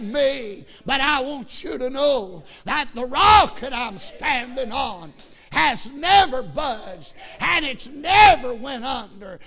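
loud, rising revival preaching that builds to a cry; preaching at full force. Acted. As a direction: preach it hard to the congregation, building with every line. Preacher's story turns to sermon.

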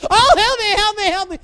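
loud, high mock cry for help; yelling for help. Boy in the pit calls to friends, acted frantic yelling. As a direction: holler in comic panic. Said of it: "I came up and I was yelling".

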